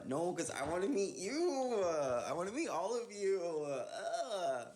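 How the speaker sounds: high-pitched, drawn-out yearning on YOU; high whining longing. Real, not acted. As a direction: whine it with longing, stretching "you". Yearning reply echoing the viewer.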